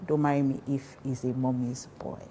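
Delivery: normal talking voice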